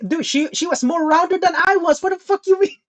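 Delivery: high-pitched, incredulous arguing; heated, exasperated comeback; indignant protest. Real, not acted. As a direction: argue back, voice shooting up high. Arguing back at listener.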